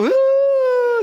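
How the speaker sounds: a sudden joyful high whoop; cheering cry. Retold or imitated. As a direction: let out a burst of emotional cheering. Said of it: "So cries".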